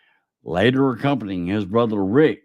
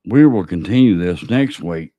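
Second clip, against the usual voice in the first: normal talking voice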